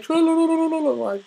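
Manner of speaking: high, loud mocking whine. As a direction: sneer it like a smug troll. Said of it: "and they're like".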